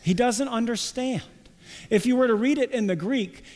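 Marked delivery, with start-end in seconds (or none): none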